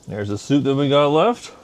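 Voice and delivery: ordinary talk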